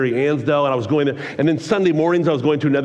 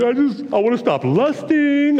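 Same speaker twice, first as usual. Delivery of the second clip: high, wailing tearful confession; imitated crying; 9 semitones higher than usual. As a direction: plead as if bawling, hammed-up. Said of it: "I cannot stop crying".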